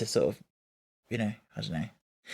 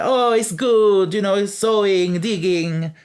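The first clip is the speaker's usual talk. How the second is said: loud, slow cheerful Italian man; upbeat Rocco; 7 semitones higher, 6 dB louder, slower than usual. Retold or imitated. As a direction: sell the bright side, slowly. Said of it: "He's like".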